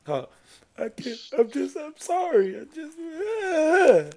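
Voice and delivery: high-pitched mock sobbing apology; fake crying. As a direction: pretend to cry, trailing into a drawn-out wail. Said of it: "Just cry and say".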